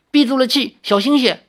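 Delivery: high urgent warning shout; urgent cry. Acted. Anu urgently warning Li Xiaoyao against the poison, shouting. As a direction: cry out fast to warn him. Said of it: "阿奴急叫说".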